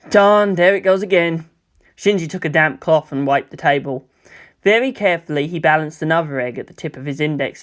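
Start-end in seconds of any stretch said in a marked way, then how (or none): none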